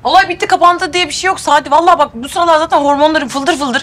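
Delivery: fuming, threatening to storm the school; angry outburst. Acted. Pregnant wife venting to Sadi about Aysel, high heated rant. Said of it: "bak nasıl sinirlendim yine".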